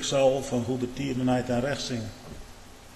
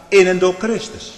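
loud, high proclamation with a rise on In; ringing pulpit declaration; proclaimed; 7 semitones higher, 10 dB louder than usual. Acted. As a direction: call it out to the whole church. Preacher proclaims, then repeats softly.

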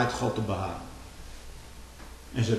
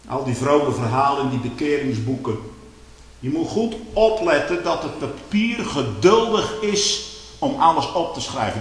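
ordinary talk

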